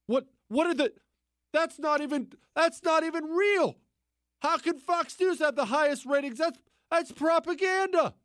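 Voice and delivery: high, indignant whiny leftist voice; mock outraged leftist. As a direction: play a shocked, pearl-clutching leftist.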